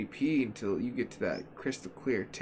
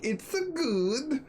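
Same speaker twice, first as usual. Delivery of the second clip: slow, much higher put-on Borat voice; Borat impression; 12 semitones higher, much slower than usual. Gamer mimics Borat's voice for a joke, slow and high. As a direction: drag out the words in a cartoonish Borat voice. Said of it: "Or like Borat".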